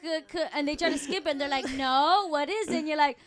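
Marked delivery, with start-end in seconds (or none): 0.0-0.5 loud mock stammer on a word; halting struggling reader. Imitating a classmate who can't read, halting stammer. 1.8-2.8 loud, higher imitation of a teacher; insistent teacher. Teacher pushing a stuck reader, loud and insistent.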